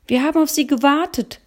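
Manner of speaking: put-on high, fast boyish voice; boy answering. Boy tells the shop owner they waited, high and quick. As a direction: answer eagerly like a young boy. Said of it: "antwortete der Ältere".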